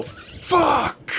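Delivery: high mock yell. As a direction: shoot it out like a tantrum. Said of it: "and go".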